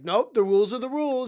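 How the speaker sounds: high, loud teacher laying down rules; strict teacher. Acted. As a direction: insist like a playground teacher. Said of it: "the teacher who's like".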